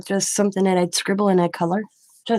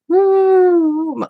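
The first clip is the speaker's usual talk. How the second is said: excited whoop; 11 semitones higher, 7 dB louder than usual. Retold or imitated. Showing her manic high state.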